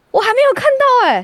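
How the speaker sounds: high indignant protest; can't believe he closed it already. Real, not acted. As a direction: pitch it up in outraged disbelief. Indignant retelling of coworker closing window.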